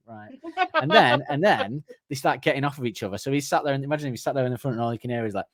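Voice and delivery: ordinary delivery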